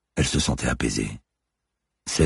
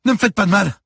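high, loud begging; frightened boy crying out; panicked cry; 7 dB louder than usual. A narrator's reading. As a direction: plead for mercy at the top of your voice. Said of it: "s'écria-t-il".

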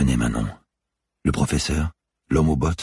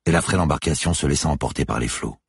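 plain reading voice

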